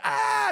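loud, high mock scream of pain; imitated scream. Worker retelling his crushed hand, imitated scream. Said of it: "I'm going".

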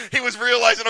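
talking through a real laugh. Real, not acted. Laughs at his own story.

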